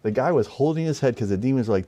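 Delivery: ordinary talk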